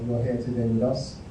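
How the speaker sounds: ordinary delivery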